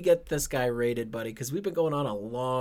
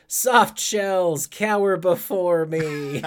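all-caps line; 3 semitones higher, 6 dB louder, much slower than usual. Acted. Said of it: "again, in all caps".

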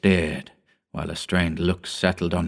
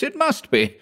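desperate insistence. A narrator's reading. Hugh insisting harder.

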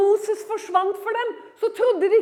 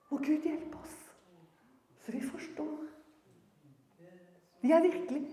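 soft, halting and earnest; hushed prayerful plea; 4 semitones lower, 7 dB quieter than usual. Real, not acted. Preacher asking God for understanding of holiness, hushed plea. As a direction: drop suddenly to a reverent hush.

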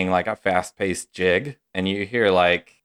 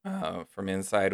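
ordinary talk, nothing marked

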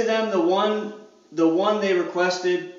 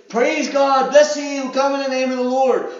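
mock excited praise; 4 semitones higher, 5 dB louder than usual. Crowd praising, herd mentality.

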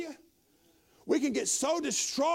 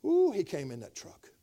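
ordinary delivery

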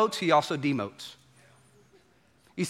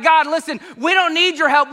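loud, raised voice waving God away; defiant crowd; 9 semitones higher, 6 dB louder than usual. Retold or imitated. People telling God to back off, loud defiance. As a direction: push God off, loud and cocky.

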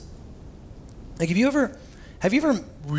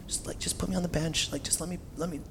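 softer, near-whispered plea; pleading whisper; 10 dB quieter than usual. Retold or imitated. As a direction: beg quietly for a place on the bench. Peter pleading to Jesus.

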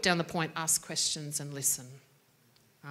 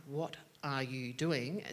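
lower, softer and slow, still firm; firm without yelling, slowed right down; 4 semitones lower, 5 dB quieter, slower than usual. Shown as a demonstration. Teacher showing firmness without yelling at a child. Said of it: "you can still be quite firm".

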